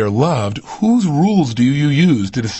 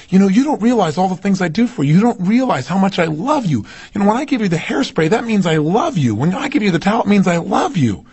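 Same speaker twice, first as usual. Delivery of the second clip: lightly voiced wife, pitched higher, earnest; a hint of the wife explaining her love, higher; imitating his wife; 7 semitones higher than usual. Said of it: "she turned to me and she said".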